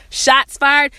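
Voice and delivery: laughing it out loud; laughing boast. Real, not acted. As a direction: crack up mid-brag.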